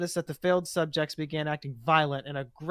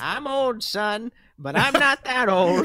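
put-on higher, slow, aged old man; 6 semitones higher, 6 dB louder, slower than usual. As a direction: creak out the line like an old-timer. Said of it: "How old should I go?".

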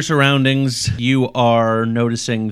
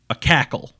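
witch cackle. Acted. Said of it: "That was a very good cackle".

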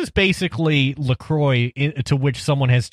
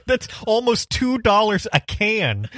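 incredulous; 9 semitones higher than usual. Real, not acted. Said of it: "Why? What?".

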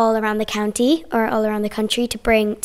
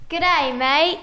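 higher-pitched put-on Australian accent; playful mock-Australian accent, pitched up; 5 semitones higher than usual. Shown as a demonstration. Pupil shows off to the interviewer in an Australian accent. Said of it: "do an Australian accent".

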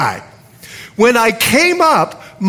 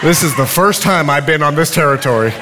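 chuckling through his own nervous thoughts; half-laughing, sheepish inner voice; 5 semitones lower, faster than usual. Retold or imitated. Preacher mocking his own thoughts with a laugh. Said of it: "You know what I was thinking?".

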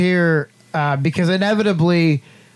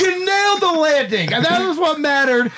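shouting; 9 semitones higher than usual. Real, not acted. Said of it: "excited praise, shouting".

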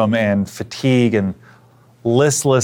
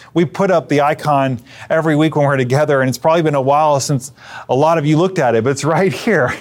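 ordinary talk, nothing marked

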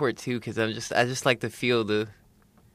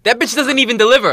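higher, louder mock outrage; 9 semitones higher, 6 dB louder than usual. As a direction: act scandalized. Joking outrage about [name].

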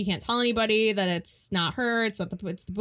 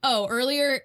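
usual speaking voice

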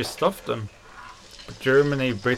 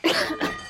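acted cough. Worker coughs over the pudding.

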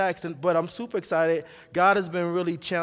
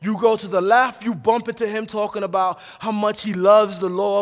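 loud, raised, driving sermon delivery; full-force preaching; 5 semitones higher, 9 dB louder than usual. Acted. Preacher telling the church how the psalmist loves God's law, full-force preaching. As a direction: preach it forcefully, punching the key words.

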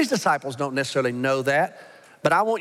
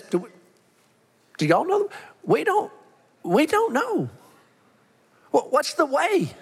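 high-pitched, halting confused disciple; Thomas, bewildered; 10 semitones higher than usual. Acted. Disciple asking the others and Jesus the way, bewildered. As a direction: stammer it out much higher, at a loss. Voices Thomas, lost and questioning.

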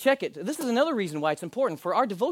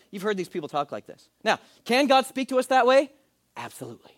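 ordinary talk

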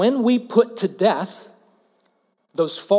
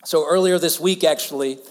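normal talking voice

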